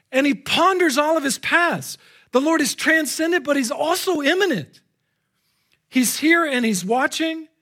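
high, impassioned pulpit delivery; ringing, fervent proclamation; full-force preaching. Real, not acted. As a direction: preach it with rising urgency.

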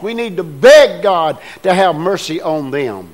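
preacher urging the church to pray for mercy; fervent preaching. Real, not acted. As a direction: press the congregation to beg God. Preaches at full force.

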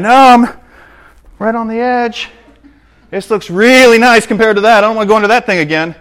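high, insistent pushback; high-pitched stubborn wanderer; stubborn protest. Acted. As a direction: argue back, set on the nice path. Stubborn wanderer arguing back.

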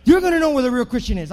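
loud, rising revival preaching; fiery sermon build with a sung lift; preaching at full force. Acted. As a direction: whip the crowd up, ringing out each line. Preaching climax, repeated rallying lines.

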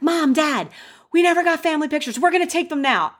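high, rushed re-enacted announcement; high, breakneck mock-frantic news; acted urgency. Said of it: "I go to my mom and I'm like".

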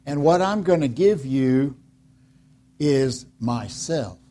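slow, deliberate, hushed; slow solemn quote, spoken gently. Retold or imitated. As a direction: give it weight, slowly. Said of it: "He says".

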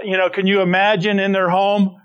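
usual speaking voice

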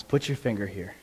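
lightly voiced gentle Jesus; gentle invitation. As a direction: speak it tenderly, no rebuke. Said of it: "he said to Thomas".

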